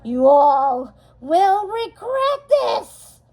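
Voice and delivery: slow, high, loud villain threat; Maleficent's slow booming curse on the children. Acted. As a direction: thunder it at them. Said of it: "she boomed".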